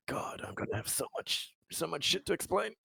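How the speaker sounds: breathy half-whisper of dread; muttered worry. Acted. Conrad mutters to himself over his reports, hushed and dreading.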